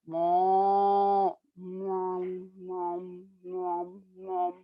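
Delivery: low steady mooing that dies down; cow mooing. Shown as a demonstration. Storyteller showing how a cow sounds, mooing. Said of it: "How does a cow sound?".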